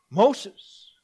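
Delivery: God calling out to Moses; divine call. Acted. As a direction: summon him by name. God calls from the bush.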